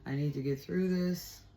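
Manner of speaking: low, soft retold plea to God; a hushed, imploring prayer; quiet prayer. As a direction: pray softly, pausing between phrases.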